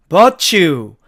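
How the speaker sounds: but you said very slowly and blended into ba-chu; but you를 버츄로 이어서; linked but you. Shown as a demonstration. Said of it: "but you가 아니고".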